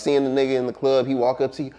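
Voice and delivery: ordinary talk, nothing marked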